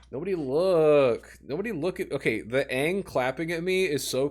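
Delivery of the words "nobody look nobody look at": ordinary talk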